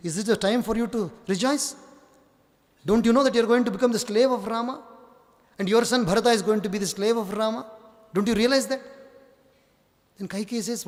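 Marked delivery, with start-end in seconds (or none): 0.1-8.8 fast, higher, pressing hard; urgent goading. Acted. Manthara warns Kaikeyi, fast and higher, urging. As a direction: rush to alarm her, voice up.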